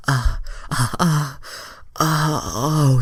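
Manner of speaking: high breathy uh-uh moaning; hammed-up moans. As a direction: moan high and staccato, overdone. Starfire moaning in fanfic.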